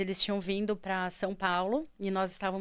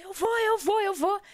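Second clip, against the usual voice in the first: high-pitched, frantic repeated cry; retold yell; 10 semitones higher, 4 dB louder than usual. Daughter held down by mother, retold yell. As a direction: re-enact a desperate cry, pitched way up. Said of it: "aí eu dei um grito".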